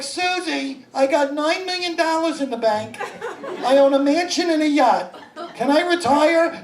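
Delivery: high, hammed-up hopeful caller; eager caller. Rich caller asking Susie about retiring, eager. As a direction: plead your case to a TV adviser.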